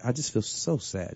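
sad voice. Pretend. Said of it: "I'm trying to get the sad voice".

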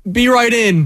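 re-enacted kid's call, pitched high; imitated calling out. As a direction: call back high, like a kid outside. Kid calling back to family indoors.

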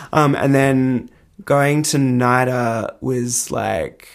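ordinary delivery

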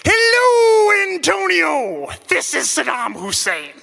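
put-on loud, high-pitched booming caller with a half-Italian, half-Scandinavian accent; comically booming phone voice, starting high and loud; Saddam Hussein, booming. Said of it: "booming voice over the receiver".

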